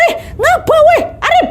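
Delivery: high, alarmed call after a running friend; a hiker yells after her fleeing friend, alarmed. Retold or imitated. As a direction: shout after him, confused. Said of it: "langsung teriak kan".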